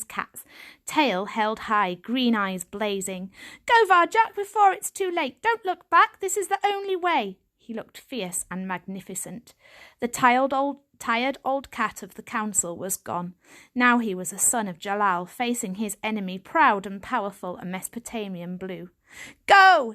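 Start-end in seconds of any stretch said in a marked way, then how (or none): 3.7-7.4 high, loud urging to flee; urgent command. A narrator's reading. Old cat ordering his grandson to flee, loud and urgent. 19.5-19.9 loud, high shout; yell. A narrator's reading. Grandfather yelling at Varjak to go, loud shout. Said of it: "he yelled".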